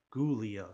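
Gulia with a punched hard G; emphasized G. Shown as a demonstration. Car fan retrying the earlier pronunciation, emphasized hard G. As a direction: hit the hard G at the start of Gulia. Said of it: "emphasizing the G".

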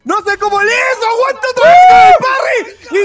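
screaming high and loud with a laugh; ecstatic shouting. Real, not acted. Cheering a last-second survival, ecstatic screaming. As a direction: erupt as the player escapes death. Clutch comeback in live match.

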